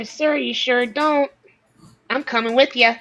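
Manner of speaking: ordinary talk, nothing marked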